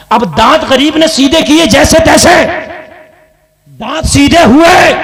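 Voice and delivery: loud preacher's cry; preaching at full force. Acted. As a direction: drive the point home to the crowd. Preacher raising voice.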